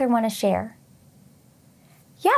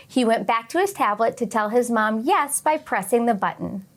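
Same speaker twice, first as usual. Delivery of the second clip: usual speaking voice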